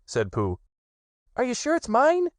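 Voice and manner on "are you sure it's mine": high, eager little squeak; excited squeak. A narrator's reading. Piglet asks Pooh if the stick is his, squeaking high. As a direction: squeak it, hoping it's true. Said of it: "squeaked Piglet excitedly".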